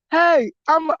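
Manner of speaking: normal talking voice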